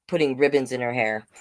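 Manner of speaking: usual speaking voice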